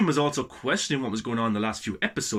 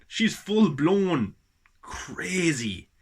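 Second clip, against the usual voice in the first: "full-blown" drawn out very slowly and pitched higher; slow emphasis on full-blown; 4 semitones higher, much slower than usual. Shown as a demonstration. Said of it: "Well, a little bit".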